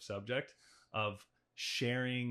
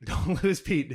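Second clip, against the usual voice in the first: laughing loudly, pitched up; 6 semitones higher, 10 dB louder than usual. Real, not acted. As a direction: burst out laughing while teasing. Joking back at the host.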